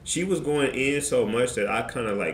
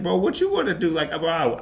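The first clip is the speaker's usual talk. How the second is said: high, loud squaring-up challenge; heated mock confrontation; retold challenge; 8 semitones higher, 4 dB louder than usual. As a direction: dare him to fight. Squaring up to the coach.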